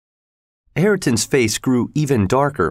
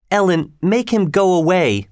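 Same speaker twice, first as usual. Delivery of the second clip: put-on high, pleading girl's voice; upset girl; 8 semitones higher than usual. A narrator's reading. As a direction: plead high to be rid of him. Upset girl rejects the boy.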